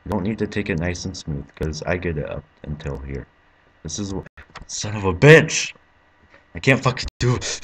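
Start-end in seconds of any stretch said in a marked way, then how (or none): 4.7-7.6 louder, slowed curse then complaint; angry outburst. Real, not acted. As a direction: snap at the game. Fails again mid-sentence.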